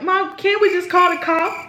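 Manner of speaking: high-pitched plea to mother; mock pleading. Daughter asking mama to avoid the fight, high mock plea.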